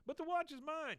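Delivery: put-on high-pitched childish protest; imitated kid's whine, very high. Boy protests to the goons.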